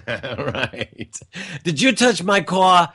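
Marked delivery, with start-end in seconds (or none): 1.6-2.9 higher, light put-on New Jersey accent; a hint of a tough New Jersey guy, New Jersey accent; playful stab at a New Jersey accent. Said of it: "he's got the accent down pretty good".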